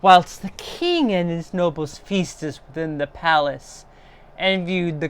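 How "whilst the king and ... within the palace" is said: plain reading voice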